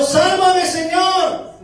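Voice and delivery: high-pitched acted cry for rescue; grito de auxilio. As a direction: call out as Peter going under. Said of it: "empezó a gritar Pedro". (grito agudo pidiendo socorro)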